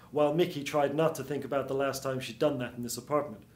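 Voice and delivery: plain reading voice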